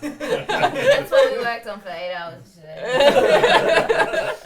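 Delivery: loud and slow through laughter; laughing, very loud. Real, not acted. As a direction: say it while cracking up. Laughing at eight hours' work.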